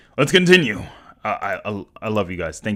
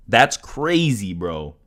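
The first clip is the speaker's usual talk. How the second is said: ordinary talk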